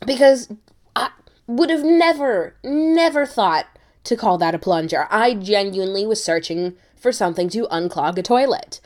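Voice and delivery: ordinary talk, nothing marked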